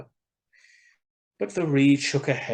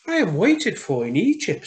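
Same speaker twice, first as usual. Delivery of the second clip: higher, louder bird voice for the swallow; swallow's voice; 8 semitones higher, 8 dB louder than usual. Acted. Swallow explaining to the reed, higher and louder. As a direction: voice the swallow up high.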